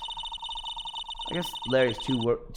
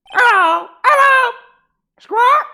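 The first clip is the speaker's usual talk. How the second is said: very high, loud squawking parrot; 15 dB louder, much slower than usual. Acted. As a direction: screech it like a parrot. Voicing the parrot Polly.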